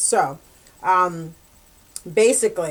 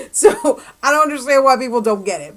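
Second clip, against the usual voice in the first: laughing while talking; 4 semitones higher, 6 dB louder, faster than usual. Real, not acted. Laughing through her complaint.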